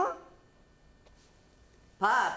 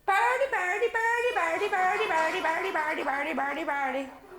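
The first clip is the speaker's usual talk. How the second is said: high cooing that fades to normal; coaxing baby talk; 7 semitones higher, 4 dB louder, faster than usual. Acted. Mama cooing birdie at the baby, high then fading. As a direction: coo it at the baby, winding down.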